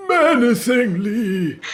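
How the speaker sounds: high, slow, hammed-up menacing drawl. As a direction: stretch it out like a cartoon villain. Quoting SpongeBob meme line.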